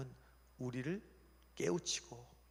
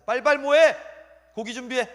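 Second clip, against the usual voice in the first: high, loud hurry-up command; acted frantic order; urgent orders; 10 semitones higher, 17 dB louder than usual. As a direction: shout at the staff to get moving. God urgently orders the fish readied.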